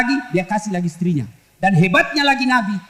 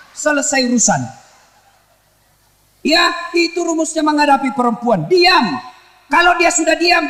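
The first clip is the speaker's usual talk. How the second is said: usual speaking voice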